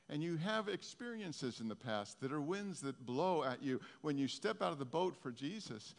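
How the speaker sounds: ordinary talk, nothing marked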